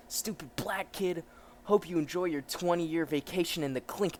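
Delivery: lightly voiced sneering policeman; a hint of a cruel lawman; contemptuous cop. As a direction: taunt the suspect with relish.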